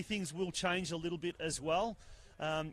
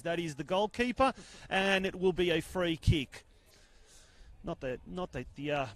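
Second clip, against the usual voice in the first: normal talking voice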